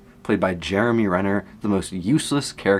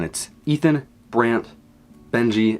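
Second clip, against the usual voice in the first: ordinary talk, nothing marked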